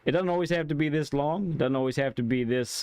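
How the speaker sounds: usual speaking voice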